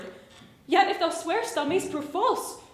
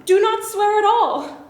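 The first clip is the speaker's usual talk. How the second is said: urgent; 5 semitones higher, 6 dB louder than usual. Acted. Juliet urgently stops his oath.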